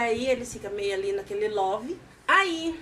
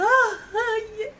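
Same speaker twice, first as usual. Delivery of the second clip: desperate; 7 semitones higher, slower than usual. Retold or imitated. Man confessing to his friend, panicked and near tears. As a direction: blurt it out in a panicked cry. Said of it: "Aí ele fala desesperado".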